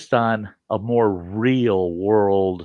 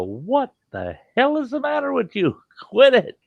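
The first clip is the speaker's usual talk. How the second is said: mock yell. Grandpa scolding his screaming grandson, mock yell. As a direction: shout down at the kid from the porch.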